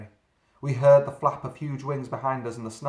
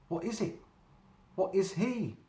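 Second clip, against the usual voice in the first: quivering; 8 semitones higher than usual. A narrator's reading.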